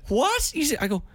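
high incredulous what; mock shocked what. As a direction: squeak it out in disbelief. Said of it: "And people go".

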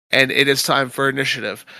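ordinary delivery

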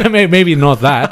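laughing through the words. Real, not acted. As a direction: chuckle it out. Laughing at backflip idea.

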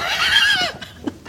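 very high squealing laugh. Real, not acted. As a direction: let out a high-pitched laugh. Laughs about accent habit.

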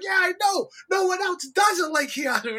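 high, shouting excited agreement; hyped agreement. Retold or imitated. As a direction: shout your agreement, thrilled. Hyped agreement about Keanu.

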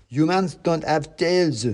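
high, slow mouse voice, correcting; pedantic mouse revolutionary. A narrator's reading. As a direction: correct the soldier slowly in character. Other mouse corrects the soldier.

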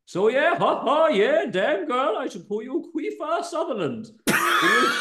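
put-on Indian accent. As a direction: ham up the teasing, giggling. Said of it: "gonna plump on Indian".

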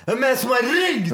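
very high, hammed-up drunken yell; acted drunken shout. Dead-drunk host demanding who phoned, acted drunken shout. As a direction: bellow it like a plastered party host. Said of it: "Skitfull står man".

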